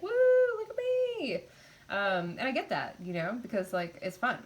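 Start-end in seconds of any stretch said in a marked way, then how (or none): 0.0-1.3 hammed-up high whoop; mock excitement. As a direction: imitate an overexcited show-off. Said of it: "people get really excited with the fire".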